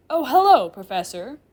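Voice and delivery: loud cheery greeting, high at the start; cheerful. Acted. Chair demon greeting the professor, cheerfully. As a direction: greet with a big cheerful swoop. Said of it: "Sullivan greets me cheerfully".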